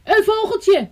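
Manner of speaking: put-on high delighted kids' voices; excited children. A narrator's reading. Nursery children discovering a bird, high and excited. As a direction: cry out like small children spotting a bird. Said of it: "riepen de kinderen".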